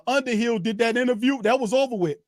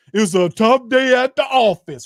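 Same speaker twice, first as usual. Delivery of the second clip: louder mock coach voice, shrugging it off; 6 dB louder than usual. Mocking the coach to Saints fans, louder mock voice. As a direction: parody the coach brushing off the loss. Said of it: "They gonna try to sell you on him".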